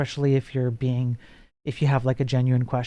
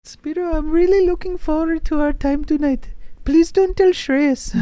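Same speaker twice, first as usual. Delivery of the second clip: coy put-on mother pleading; mock mom voice. Voicing the mom again.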